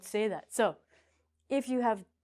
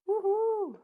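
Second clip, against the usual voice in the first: excited cheer; 12 semitones higher, 4 dB louder than usual. Real, not acted. Teaching trick, cheering.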